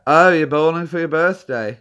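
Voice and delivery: mock British accent, pushed up and loud; Brad. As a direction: imitate a British pal on a prank call. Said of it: "I was doing Brad's voice".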